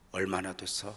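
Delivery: usual speaking voice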